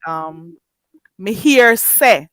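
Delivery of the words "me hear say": me hear say, slow, with the H dropped; patois phrase. Shown as a demonstration. Teacher showing the Jamaican phrase slowly. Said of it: "So you say".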